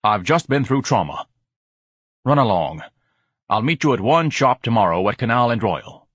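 mildly pompous, put-upon character voice; weary sighing. A narrator's reading. Ignatius sends George away after his trauma, mildly sighing. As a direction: dismiss him with a touch of weariness. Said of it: "Ignatius sighed".

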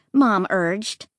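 ordinary delivery, nothing marked

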